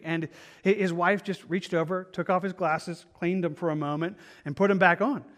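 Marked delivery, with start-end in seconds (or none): none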